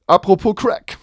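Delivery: hyper, high, jittery wordplay; too much caffeine. Real, not acted. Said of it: "ich hab wirklich zu viel Kaffee gesoffen".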